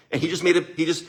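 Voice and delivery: ordinary talk, nothing marked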